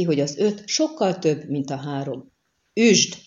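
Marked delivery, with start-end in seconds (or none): 2.7-3.1 short, loud, higher-pitched attack cry; shouted order. A narrator's reading. Bandit leader ordering his men to attack, shouting. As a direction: yell the order to strike. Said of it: "Ordította".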